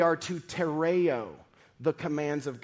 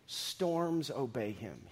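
slow emphasis; 4 semitones lower, 8 dB quieter, much slower than usual. Real, not acted. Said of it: "slow emphatic statement".

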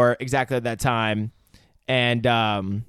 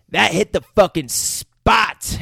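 slow savoring; 6 semitones higher, 4 dB louder, much slower than usual. Retold or imitated. Jackson quote retold.

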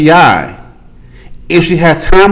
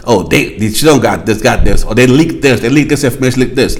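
lower, mumbled rumours; low mumbling excuses; 9 semitones lower, faster than usual. Retold or imitated. Mocking the news excuses in a low mumble. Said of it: "Next day I heard".

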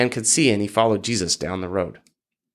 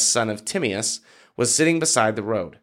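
ordinary delivery

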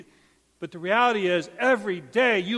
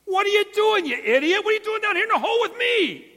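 much higher, loud, fast disbelieving cry; incredulous outburst; 13 semitones higher, 5 dB louder than usual. Acted. Man in a hole shouts at his friend for jumping in, disbelieving. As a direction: call him an idiot in shock.

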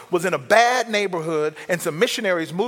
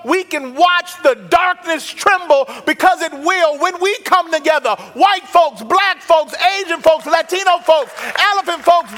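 preaching at full force; 11 semitones higher, 7 dB louder than usual. Acted. Pastor rallying the church to turn the light on, preaching at full force. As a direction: lift the whole room to its feet.

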